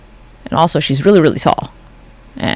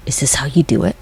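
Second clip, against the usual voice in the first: breathy unvoiced whisper, voice changed; mock whisper; 4 semitones lower, 3 dB quieter than usual. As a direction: whisper it like a nervous impostor. Acted whisper of the lookalike.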